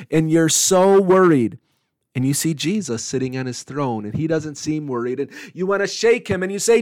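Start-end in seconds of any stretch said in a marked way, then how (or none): none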